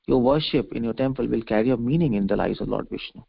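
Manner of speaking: usual speaking voice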